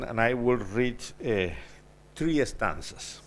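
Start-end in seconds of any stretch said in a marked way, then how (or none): none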